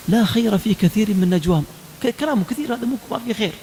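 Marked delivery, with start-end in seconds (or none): 0.1-1.6 loud, measured scripture recitation; Quran recitation. Real, not acted. Preacher citing the Quran to his listeners, reciting firmly. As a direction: recite the verse with weight. Quoting what the Quran says.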